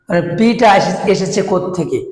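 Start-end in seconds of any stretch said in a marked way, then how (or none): none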